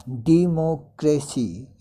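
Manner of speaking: "डेमोक्रेसी" slow and loud, four separate syllables. Shown as a demonstration. Teacher counting syllables for learners, "डेमोक्रेसी" slowly in four parts. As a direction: break "डेमोक्रेसी" into four drawn-out beats. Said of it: "इसमें चार आ रहे हैं".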